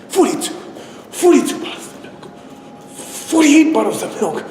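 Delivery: high, straining broken cries; frantic, high failing chant; desperate refusal to give up. Retold or imitated. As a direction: push out the count at any cost. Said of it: "he wouldn't give up at no cost".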